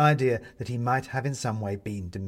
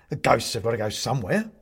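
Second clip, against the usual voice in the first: indignant; 4 dB louder than usual. A narrator's reading. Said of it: "a little indignantly".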